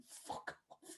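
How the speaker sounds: an almost silent, breathless curse under the breath; exhausted athlete cursing his trainer under his breath; muttered fuck off. Retold or imitated. Michael Jordan on the floor cursing his trainer, hushed. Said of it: "and he's, like, thinking".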